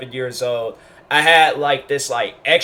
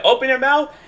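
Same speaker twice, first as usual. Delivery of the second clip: teacher's command, high; 11 semitones higher than usual. Retold or imitated. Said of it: "she was like".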